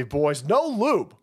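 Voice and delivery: normal talking voice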